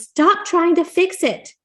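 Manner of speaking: high, loud and slow, fed up; wife cuts off her husband in a loud exasperated cry; exasperated shout. Acted. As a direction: snap at him to stop fixing. Wife in video loses patience.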